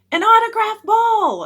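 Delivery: very high, loud begging yell; excited high holler; kid yelling. A narrator's reading. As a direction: yell for a souvenir from the stands. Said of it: "another kid yelled".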